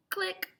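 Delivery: click. Pretend. Camera click.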